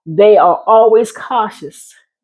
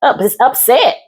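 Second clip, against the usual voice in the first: ordinary talk, nothing marked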